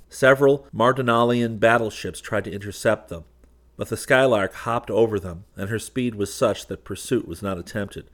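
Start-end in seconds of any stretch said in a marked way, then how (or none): none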